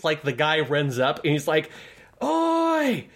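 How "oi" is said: very high, drawn-out "Oi!" across a field; distant call. Retold or imitated. Imitating the host running up, far-off high call. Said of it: "from a distance".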